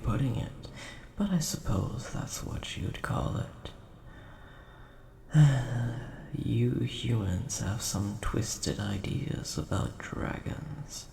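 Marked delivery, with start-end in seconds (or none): 5.3-6.3 audible exhaled sigh; weary sigh. Acted. Dragon tired of human ideas about dragons, weary sigh. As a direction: let out a sigh of mild exasperation. Dragon wearied by knight's rude wording.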